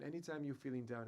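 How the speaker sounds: normal talking voice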